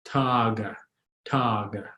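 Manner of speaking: TA-ga, first syllable held in "Taga"; low, soft "Taga" with long first vowel; stress on second-to-last syllable. Shown as a demonstration. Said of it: "go second to last".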